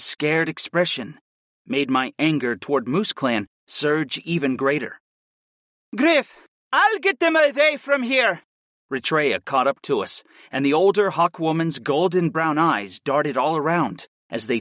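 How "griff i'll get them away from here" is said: high, raised battle cry; urgent call. A narrator's reading. Older hawk woman to Griff mid-battle, calling urgently.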